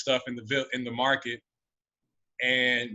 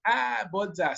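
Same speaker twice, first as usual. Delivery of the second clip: admonishing Malawian; 7 semitones higher, slower than usual. Retold or imitated. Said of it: "what they do to, like, admonish".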